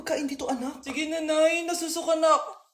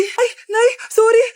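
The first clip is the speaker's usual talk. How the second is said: loud tearful wail of sorry; sobbing sorry to mother; crying apology; 5 semitones higher, 7 dB louder than usual. Acted. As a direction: cry out sorry to mom after a fall. Child hurt, crying to mother.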